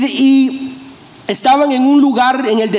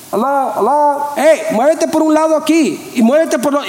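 calling out to crowd; 3 semitones higher than usual. Acted. Discípulo organizando la multitud a gritos.

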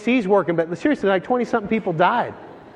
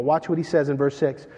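usual speaking voice

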